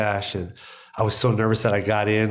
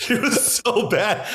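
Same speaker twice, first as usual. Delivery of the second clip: higher, slow words through real laughter; laughing; 10 semitones higher, slower than usual. Real, not acted. To the host, about the tape, laughing it out. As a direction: laugh at how tiny it sounded.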